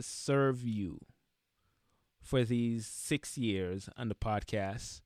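soft, slow, heartfelt thank-you; sincere thanks. Real, not acted. Podcaster thanking longtime listeners, softly and sincerely. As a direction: let the gratitude slow you down, gently. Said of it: "I'm getting a little emotional".

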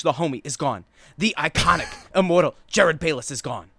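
emphatic pundit monologue; Stephen A impression. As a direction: build the case like a hot-take host. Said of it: "I was gonna go Stephen A on this".

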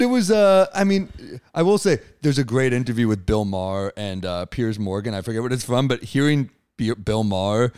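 usual speaking voice

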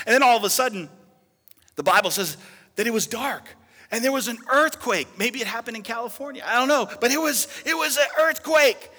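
high, loud preacher's build; preaching shout. Real, not acted. Preacher telling the church of the earthquake, loud. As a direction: thunder out the earthquake.

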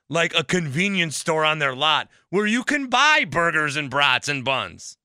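normal talking voice

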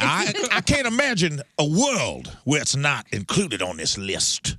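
put-on high-born Southern accent. Mock outrage about the list, high-born Southern accent. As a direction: play the grand Southern aristocrat. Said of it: "the high-born Southern accent".

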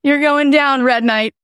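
you're going down, teased loud; playful taunt. Pretend. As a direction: taunt like a wrestling announcer. Mock taunt.

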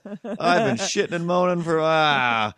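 high, loud cat grumbling. Retold or imitated. The cat grumbling about its own moaning, high and loud. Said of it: "he was like".

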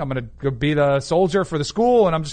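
normal talking voice

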